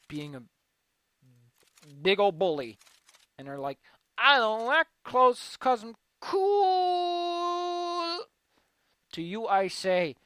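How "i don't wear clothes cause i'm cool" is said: mocking whiny voice. Mocking quote, high slow.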